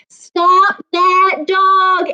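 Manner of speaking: high, loud, very slow cry. Acted. Townspeople chasing the dog, loud drawn-out cry. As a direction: stretch it out like a crowd yelling after a dog. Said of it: "everybody cried".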